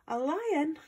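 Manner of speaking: high-pitched startled exclamation; small queen's shocked outburst; alarmed cry. A narrator's reading. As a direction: cry out in disbelief, pitch jumping up. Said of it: "cried the Queen".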